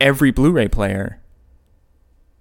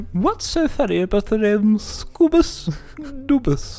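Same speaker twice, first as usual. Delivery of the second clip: high mock voice; 9 semitones higher, slower than usual. Playful high mock question.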